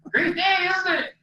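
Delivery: acted-out gleeful hello; happy greeting. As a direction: chirp it out, delighted just to be there. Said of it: "I was just happy to be there".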